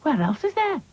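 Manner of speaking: high, mildly nervous question; a touch of worry; nervously. Acted. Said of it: "asked Lucy nervously".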